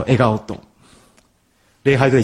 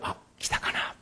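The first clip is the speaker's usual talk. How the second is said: inner thought; 16 dB quieter, slower than usual. Retold or imitated. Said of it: "目の前の信号は赤".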